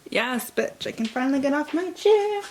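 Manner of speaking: ordinary talk, nothing marked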